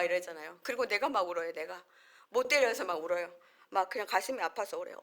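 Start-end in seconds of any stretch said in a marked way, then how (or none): none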